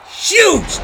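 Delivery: huge yelled high and loud; stress on huge. Real, not acted. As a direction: blast out the word huge. Emphasizing the big man.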